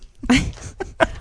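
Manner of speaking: high airy giggle; squeaky gleeful gremlin giggle. Real, not acted. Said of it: "I sound like a gremlin?".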